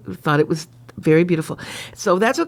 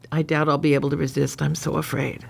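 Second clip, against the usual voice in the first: low, softening confessional read; hushed end of a romance reading; story narration; 6 semitones lower, 4 dB quieter than usual. Acted. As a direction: read it low, trailing into softness.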